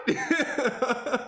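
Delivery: high loud laugh. Real, not acted. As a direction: bursts out laughing. Laughs at own retort.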